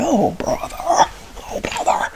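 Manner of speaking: mock exasperation. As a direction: imitate kids groaning at dad's sentimentality. Kids' exasperated reply, retold.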